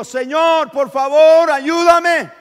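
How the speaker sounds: very loud, high cry for help; desperate shouted plea. Acted. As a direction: scream it as a last try. Woman tries once more.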